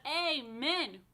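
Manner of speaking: a big loud amen. Real, not acted. Rabbi leading the children in a loud amen. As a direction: call out amen loud and proud. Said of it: "let us all say nice, big, loud".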